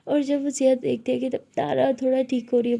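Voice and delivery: ordinary delivery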